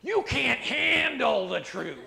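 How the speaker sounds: slow, loud, laughing courtroom snap; Jack Nicholson impression. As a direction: drag it out, amused and scornful. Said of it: "And Jack Nicholson says".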